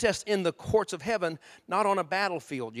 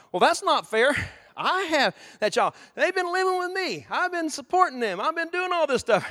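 high, aggrieved complaint; indignant protest; 9 semitones higher, 4 dB louder than usual. Retold or imitated. Parent protesting to the police officer, high and aggrieved. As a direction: whine that it isn't fair.

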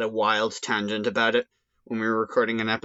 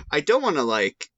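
usual speaking voice

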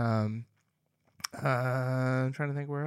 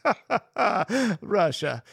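bad Russian accent laugh; hammy Russian villain laugh; 7 semitones higher, 4 dB louder, slower than usual. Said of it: "that was my Russian accent".

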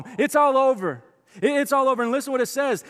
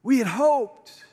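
quiet grief; 4 semitones higher, much slower than usual. Retold or imitated. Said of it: "they even say that we had hoped".

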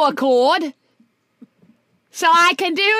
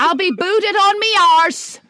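jokey Cockney complaint, a bit louder; mock Cockney; 4 semitones higher, 5 dB louder than usual. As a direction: grumble like a Cockney about to be sacked. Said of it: "your approximation of a Cockney accent".

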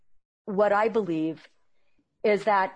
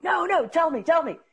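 eager plea; 9 semitones higher, 5 dB louder than usual. Retold or imitated. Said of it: "I'm like".

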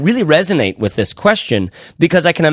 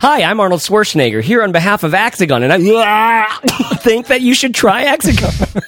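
Arnold Schwarzenegger; 8 semitones higher than usual. Acted. Host faking an Austrian Arnold ad read, hammed up. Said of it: "I'll just start again".

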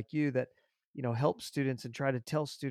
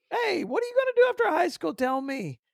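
much higher, louder coaxing retold question; 5 dB louder than usual. Counselor coaxing a silent student a second time, much higher. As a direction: coax him gently into answering.

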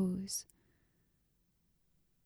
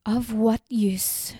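voice shaking; 6 dB louder than usual. A narrator's reading. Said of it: "her voice shaking".

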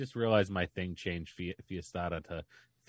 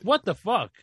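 exasperated; 9 semitones higher, 8 dB louder than usual. Real, not acted. Outraged punchline.

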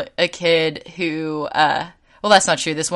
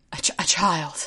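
breathy whispered repeat; 5 dB quieter than usual. Pretend. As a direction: whisper it as a private aside. Repeating the word for effect.

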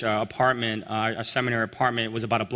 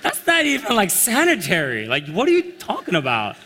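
high, stunned protest; can't believe what he's hearing; incredulous; 7 semitones higher than usual. Retold or imitated. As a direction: pitch shooting way up in disbelief. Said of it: "And I'm like".

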